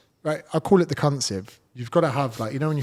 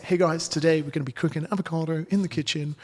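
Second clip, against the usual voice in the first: cheesy presenter; 5 semitones higher than usual. Retold or imitated. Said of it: "if I was like".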